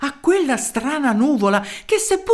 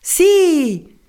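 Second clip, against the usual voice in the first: a high, loud shouted yes; crowd shout; 8 semitones higher, 6 dB louder than usual. Acted. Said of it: "gli fecero eco altre voci".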